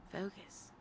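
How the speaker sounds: a hushed, unvoiced reminder; whispered self-reminder. Real, not acted. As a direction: whisper it to yourself.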